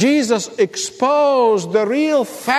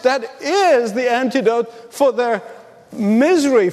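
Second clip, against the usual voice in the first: normal talking voice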